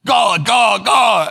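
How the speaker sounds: shouting "God" through laughter; loud exclamation cracking up; delighted loud outburst; loud laughing shout. Real, not acted.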